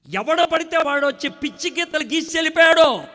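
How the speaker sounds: very loud, high, cracking cry; anguished outcry. Acted. Preacher crying out about his scarred heart, very loud.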